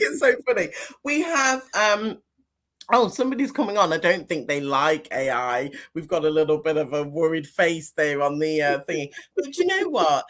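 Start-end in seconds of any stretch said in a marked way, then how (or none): none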